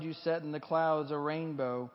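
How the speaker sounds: usual speaking voice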